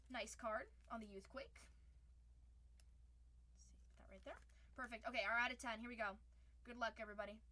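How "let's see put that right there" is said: soft whisper to self; hushed aside. Real, not acted. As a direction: murmur as you place the card. Quietly arranging cards.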